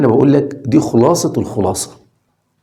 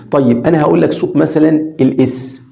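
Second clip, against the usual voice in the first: ordinary talk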